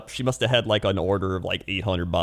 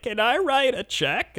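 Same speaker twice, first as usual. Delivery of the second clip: put-on high, slow little old lady voice; old lady in line; 13 semitones higher, slower than usual. Customers mock a slow old lady, high and slow. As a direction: play a dithering old lady at checkout. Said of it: "She's like".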